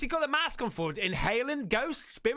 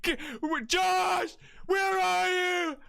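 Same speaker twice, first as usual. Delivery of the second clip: Blair Witch yelling; 7 semitones higher, much slower than usual. Retold or imitated. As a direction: yell for Josh lost in the dark woods. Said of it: "It's like".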